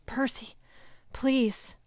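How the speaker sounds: pleading. Acted. Wounded girl pleading.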